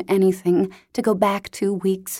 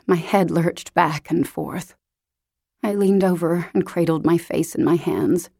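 usual speaking voice

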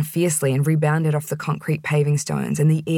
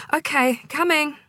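much higher, brightly fake okay; forced cheer; 11 semitones higher than usual. A narrator's reading. Clerk hiding annoyance from the receptionist, fake bright. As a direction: swallow the annoyance. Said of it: "so I merely said".

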